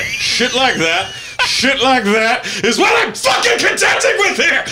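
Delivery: high, loud, laughing rant; laughing shout. Real, not acted. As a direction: yell it in exasperated laughter. Frustrated outburst.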